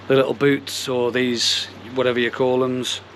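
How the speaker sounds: ordinary delivery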